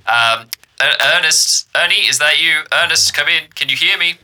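loud, high radio call through crackle; voice through static. Acted. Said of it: "through the crackle".

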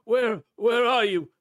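high, loud strained cry; wretched man crying out in accusation; desperate crawling man. Acted. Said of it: "a man comes crawling".

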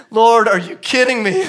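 high, loud, drawn-out disbelief; incredulous complaint. Retold or imitated. Friend protests to God over the squash, incredulous. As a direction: protest to heaven in comic exasperation.

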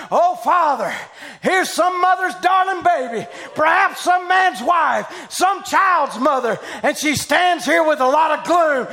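loud revival preacher, pitch high and shouting; preaching at full force. Acted. Preacher shouting over a woman at the altar, full force. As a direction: preach it out to the whole hall.

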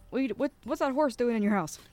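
usual speaking voice

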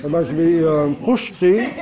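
talking through a mouthful of food; words muffled by a bite of food; mouth full. Real, not acted. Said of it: "Pardon, j'ai la bouche pleine".

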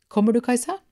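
high, loud call from up the hill. Acted. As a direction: shout it up to a friend far away. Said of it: "ropte jentene fra fjellet".

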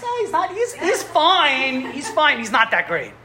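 high, slow offhand shrug of a voice; humble rabbi playing himself down; modest dismissal. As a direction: brush off the praise, drawing it out. Chafetz Chaim keeps downplaying himself.

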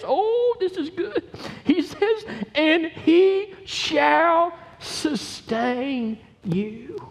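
high-pitched delight, stretching the verse out; thrilled preacher drawing out each word; excited relish. Real, not acted. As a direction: savour the scripture, high and very slow. Relishing the verse before reading it.

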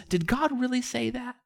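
high, softened sceptical question; accusatory doubt. Retold or imitated. The doubter casting suspicion on God's word, accusatory. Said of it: "this accusatory tone".

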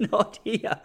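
laughing through the words; amused mock refusal. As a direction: repeat it while laughing. Repeated with a laugh.

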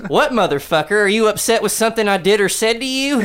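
brash aunt taunting; mock aunt confronting. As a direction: square up to him, hammed-up. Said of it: "she goes".

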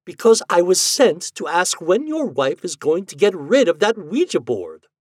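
a touch of nagging, voice pushed up; irritated. Acted. Ghost tells husband why she was sent, irritated.

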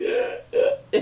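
loud mock gasp; panicked gasping. Looking at the uncashed paycheck, mock-panicked gasp. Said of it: "I freaked out".

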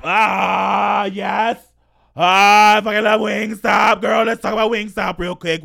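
loud, high, thrilled cry of delight; excited gush. Real, not acted. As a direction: burst out with joy over it. Excited about favorite restaurant.

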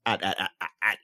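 stammering dragon in Mark's voice; a light Mark impression, stuttering. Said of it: "I'm trying to do it in the Mark voice".

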